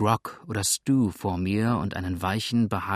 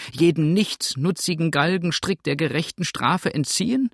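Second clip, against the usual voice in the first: high, loud scolding landlady; indignant tirade, rising in pitch; indignant widow; 7 semitones higher, 5 dB louder than usual. Acted. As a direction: rail at a late-night caller.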